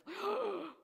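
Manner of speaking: sharp high gasp of fright; high startled gasp; frightened gasp. Acted. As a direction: gasp like you've just seen an angel. Zechariah frightened by angel.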